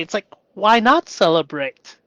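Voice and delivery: ordinary talk, nothing marked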